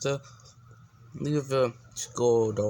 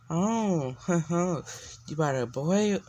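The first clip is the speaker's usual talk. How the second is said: laughing tease, higher; teasing laugh; 6 semitones higher, slower than usual. Acted. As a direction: chuckle through it, teasing her daughter. Mother teases daughter about boy.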